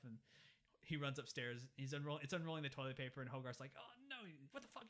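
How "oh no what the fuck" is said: high, soft, frantic squeal; hushed panic. Retold or imitated. Boy alarmed by the hand in the bathroom, hushed mock panic. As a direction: panic under your breath, high-pitched.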